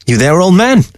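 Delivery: lightly voiced fox hailing the old man; mock-calling fox. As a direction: call over in a lifted voice.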